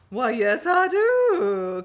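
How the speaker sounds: loud, high, drawn-out delight; gushing. Retold or imitated. Fan jumping at an introduction to a creator, gushing. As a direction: belt it out like a thrilled fan.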